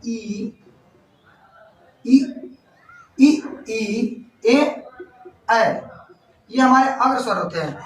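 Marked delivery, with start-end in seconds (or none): 0.0-5.7 ई, ए, ऐ sounded out slowly one by one; front vowel demo. Shown as a demonstration. Teacher modelling front vowels for students. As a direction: say each front vowel clearly and apart. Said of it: "अग्र स्वर कौन कौन से होते हैं".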